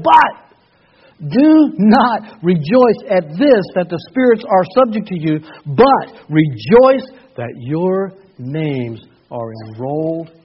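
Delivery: booming pulpit reading of scripture; full-force preaching. Acted. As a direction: proclaim it to the back row. Preaching Jesus's words at full force.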